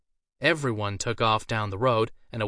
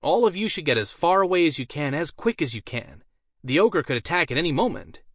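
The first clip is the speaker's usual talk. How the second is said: raised, urgent warning to the crowd; mage warning villagers; 6 semitones higher, 4 dB louder than usual. A narrator's reading. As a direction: call them away before the ogre comes.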